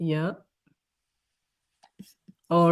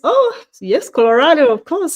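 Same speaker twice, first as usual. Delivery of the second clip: high, loud burst of delight; light up at the viewer's home state; excited greeting; 9 semitones higher, 8 dB louder than usual. Real, not acted. Host greets viewer from Colorado.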